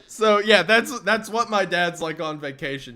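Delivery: ordinary talk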